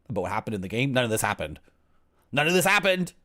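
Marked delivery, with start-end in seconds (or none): 2.3-3.1 higher, louder indignant outburst; indignant shout. Real, not acted. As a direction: yell it in nerdy outrage. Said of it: "rant about made-up plot".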